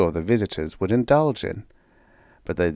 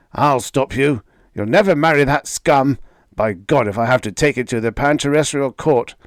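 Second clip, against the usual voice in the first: angry threat; 5 semitones higher, 5 dB louder than usual. A narrator's reading. Father threatening.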